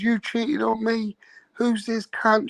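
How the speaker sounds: high-pitched slow accusation; imitating furious girlfriend. Imitating the betrayed girlfriend confronting her, high angry voice. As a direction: spit out the betrayal. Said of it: "she goes".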